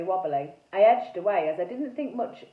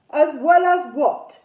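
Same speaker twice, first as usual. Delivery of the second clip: loud, slow, booming knight ghost; 4 semitones higher, 11 dB louder, slower than usual. A narrator's reading. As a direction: boom the question out slowly. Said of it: "he boomed".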